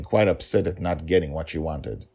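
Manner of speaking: plain reading voice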